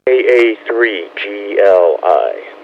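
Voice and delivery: slow, careful call sign; over enunciating. Real, not acted. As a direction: spell each letter out slowly. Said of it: "give me your call one more time slowly, please".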